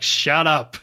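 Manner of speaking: imitating a loud, curt put-down; blunt mock snap. Bond to henchman in the suitcase, blunt mock shout. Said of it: "It's another blunt Bond moment".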